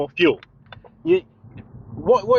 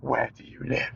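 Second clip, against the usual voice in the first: low, softer, threatening "Where do you live?"; menacing question; 6 semitones lower, 9 dB quieter than usual. Retold or imitated. Irate sales guy menacing the caller, low and quiet. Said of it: "he starts getting irate".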